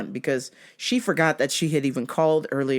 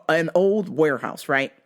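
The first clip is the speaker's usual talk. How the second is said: usual speaking voice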